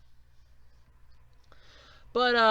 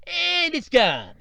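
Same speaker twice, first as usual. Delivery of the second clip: high, drawn-out banker impression; South Park banker; 7 semitones higher, 4 dB louder, slower than usual. Banker telling a customer the money vanished, drawn-out impression. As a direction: stretch it out slowly, pitched up. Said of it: "that South Park episode with the banker".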